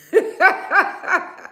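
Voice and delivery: loud high laugh. Real, not acted. Vlogger laughing at followers, loud high laugh. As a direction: burst out laughing.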